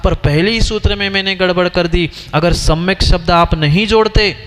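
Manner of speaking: usual speaking voice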